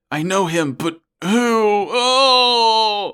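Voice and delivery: high, drawn-out mock anguished wail; anguished confusion. Host acts out Troa clutching his head, mock anguish. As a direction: ham up a tormented struggle to remember. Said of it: "clutching his head and is like".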